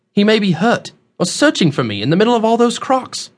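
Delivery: put-on high, anxious young man's voice; worried young traveler. Young man fears friend is hurt among crocs, worried. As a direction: plead urgently with the pitch lifted.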